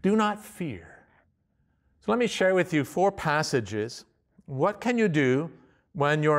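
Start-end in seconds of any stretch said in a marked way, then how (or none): 0.0-0.9 higher, softer gentle comfort; reassuring God. Pretend. God telling his people not to fear, soft and high. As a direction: hush their fear tenderly. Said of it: "God says".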